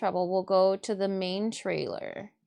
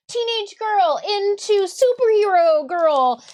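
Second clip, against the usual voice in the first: dramatic transformation; 11 semitones higher, 9 dB louder than usual. Pretend. Describing the magical transformation.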